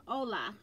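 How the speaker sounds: "hola" starting straight on the O; silent H. Shown as a demonstration. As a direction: say "hola" as OH-la, without any H. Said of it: "not holla".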